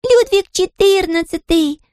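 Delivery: very high, slow chick squeak; tiny scared chick voice; frightened squeak. A narrator's reading. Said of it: "запищала испуганно Тутта Карлсон".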